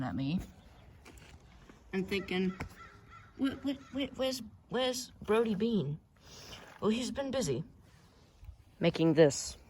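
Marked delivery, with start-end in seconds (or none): 3.4-6.0 puzzled viewer. Retold or imitated. Voicing viewers' puzzled thought.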